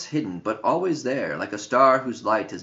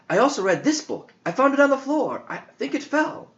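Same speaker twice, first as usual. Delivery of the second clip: put-on high, quick boyish voice; boy rushing to explain, high and fast; young John; 13 semitones higher, faster than usual. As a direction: tumble it out like an excited kid, pitched up. John's voice in story.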